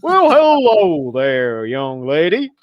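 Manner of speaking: slow, high, booming Southern drawl; terrible booming Southern voice; Southern US accent. Shown as a demonstration. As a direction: stretch it out in a hammed-up Southern accent. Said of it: "or something like that accent there".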